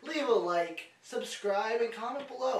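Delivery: loud, punchy announcer outro; big-voiced host sign-off; show sign-off. Real, not acted. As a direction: belt out the closing plugs.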